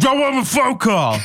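high, slurred demand; garbled, high-pitched holler; mock drunken yell. Drunk suspect yelling, retold.

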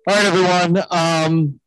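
nervous pilot. Pretend. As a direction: sound like a pilot unsure of himself. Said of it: "can you imagine?".

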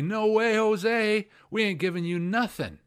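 high, loud taunting "no way, Jose"; mocking townsfolk refusal. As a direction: jeer the refusal back at Gideon.

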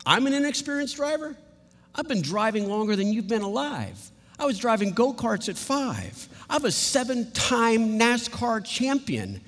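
high-pitched, incredulous driver defending himself; indignant. Acted. Insulted driver answers the passenger who doubts him, indignant.

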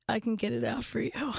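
lightly voiced solemn little boy; understated, dead-earnest offer; very serious. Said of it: "so seriously and he goes".

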